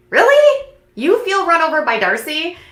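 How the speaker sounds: incredulous. Real, not acted. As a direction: scoff in high-pitched disbelief. Scoffing at Tom's complaint.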